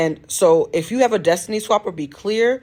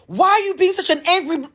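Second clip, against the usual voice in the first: much higher and louder, cut off mid-word; screaming insult; 14 semitones higher, 5 dB louder, faster than usual. Retold or imitated. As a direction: fling the insult in a high yell. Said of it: "she is screaming".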